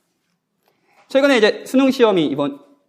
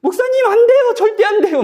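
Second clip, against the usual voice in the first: put-on much higher, louder teenagers crying out no; teen protest; 4 dB louder than usual. Pastor imitates youth group kids begging him not to quit, high and loud. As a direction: squeal it like alarmed teenagers. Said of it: "막 이러더라고요".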